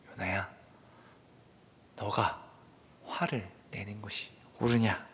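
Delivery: low, soft, measured questioning; God. Acted. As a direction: ask quietly without anger, pausing between phrases. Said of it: "화를 내시지 않고 묻습니다".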